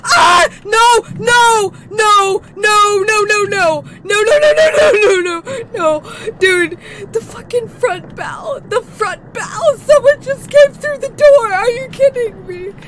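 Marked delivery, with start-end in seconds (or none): 0.0-6.3 high, loud terrified shrieks of no; panicked screaming. Real, not acted. Jump scare in horror game. 9.8-12.7 panicked alarm. Real, not acted. Hears intruder in horror game.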